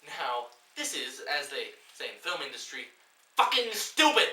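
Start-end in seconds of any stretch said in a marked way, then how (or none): 3.4-4.3 high, loud exasperated shout; outraged yell; furious outburst. Real, not acted. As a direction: blow up at the screen. Ranting at the film.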